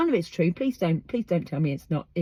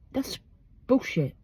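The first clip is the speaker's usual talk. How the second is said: ordinary delivery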